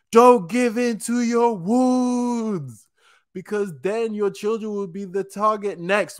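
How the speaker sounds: high, drawn-out acted cry; impassioned anime-style appeal, pitched well up; dramatic plea.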